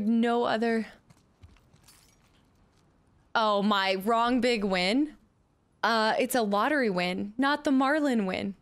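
ordinary talk

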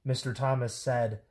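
high, lively storybook voices with swooping pitch; bouncy picture-book read-aloud; animated children's story reading. A narrator's reading. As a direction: read it to kids, playing up every silly word.

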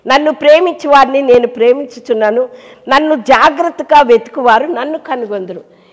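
proclaiming scripture. Real, not acted. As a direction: ring out the verse to the church. Loud scripture reading. (బిగ్గరగా వచన పఠనం)

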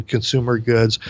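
usual speaking voice

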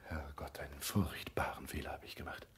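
whispered in dismay; a frightened whisper to himself; horrified whisper. Acted. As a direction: breathe out the horror of the mistake. Panicked realisation of a mistake.

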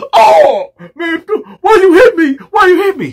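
high, whining cries of a man who just got hit; crying out after a slap. Retold or imitated. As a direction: wail it like he's hurt and scared. Victim slapped, crying out.

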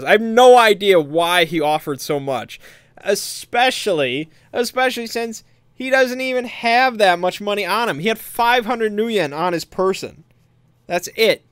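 high-pitched, exasperated disbelief; can't believe the idiocy, pitch climbing; real incredulity. Real, not acted. Player telling how his teammate overpaid, incredulous.

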